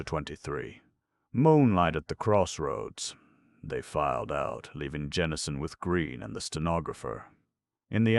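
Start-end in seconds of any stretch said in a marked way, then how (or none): none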